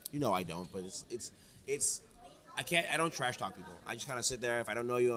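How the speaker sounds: usual speaking voice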